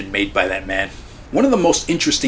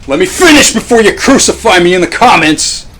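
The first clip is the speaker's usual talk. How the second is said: loud plea; 11 semitones higher, 9 dB louder than usual. Real, not acted. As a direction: shout it high and loud, half-joking. Pleading with commenters, pre-empting outrage.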